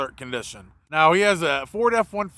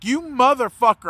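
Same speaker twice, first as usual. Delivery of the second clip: retold angry insult; 6 semitones higher than usual. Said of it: "now I'm mad at this point".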